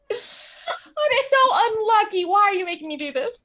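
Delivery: high, whiny comic despair; mock wailing. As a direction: moan playfully at the game master. Player wails about luck roll.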